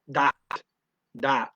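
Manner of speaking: dot with a hard d for th. Shown as a demonstration. Teacher showing Dutch dat for English that. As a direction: say dot, d not th. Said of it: "Change the T H to a D".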